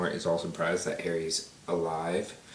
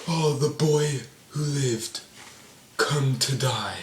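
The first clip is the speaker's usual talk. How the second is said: slow, higher hammed-up villain voice; Voldemort; 7 semitones higher, much slower than usual. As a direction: draw it out as a theatrical villain taunt. Voices Voldemort facing Harry in battle.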